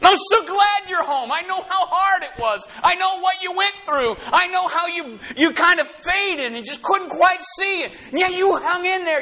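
high, gentle welcoming voice; tender welcome. Acted. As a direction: embrace the weary child coming home. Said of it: "he says".

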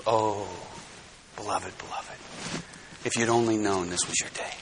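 grieving God over a lost soul; sorrowful lament. Acted. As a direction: mourn a missed chance gently.

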